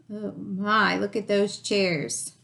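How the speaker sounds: ordinary delivery, nothing marked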